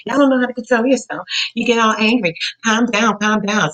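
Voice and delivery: high-pitched nagging imitation; mock scolding. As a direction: imitate someone telling you off. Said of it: "You know how they'll say".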